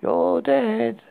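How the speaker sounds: sing-song it like a ghost; creepy little girl. A narrator's reading. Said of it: "laughed over and over and said".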